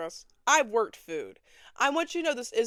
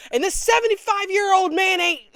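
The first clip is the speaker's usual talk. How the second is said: excited loud punchline; 11 semitones higher, 12 dB louder than usual. Real, not acted. Joking about old man.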